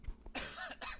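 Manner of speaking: real cough, choking on coffee; coughing fit. Real, not acted. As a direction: cough hard, something went down wrong. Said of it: "I'm choking on coffee".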